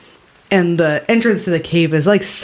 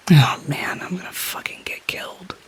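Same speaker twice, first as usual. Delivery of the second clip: fading into a whisper; whispered dread; 6 dB quieter than usual. Acted. Talrin fears he will be killed, whispering to himself. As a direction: whisper it to yourself, doomed.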